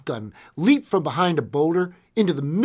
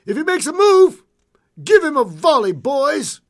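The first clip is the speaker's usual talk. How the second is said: full shout, very high and loud; shouted command; 14 semitones higher, 10 dB louder than usual. A narrator's reading. Gunman shouting to his men on the hillside, loud high yell. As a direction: yell it across to hidden men. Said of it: "glanced toward the boulder-strewn hillside and shouted".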